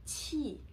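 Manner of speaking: qi with flat tongue and flat lips, aspirated; Mandarin qi. Shown as a demonstration. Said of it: "in Chinese".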